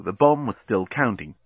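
plain reading voice

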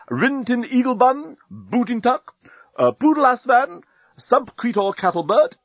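brisk military roll call; rattling off names. Acted. Captain continues roll call.

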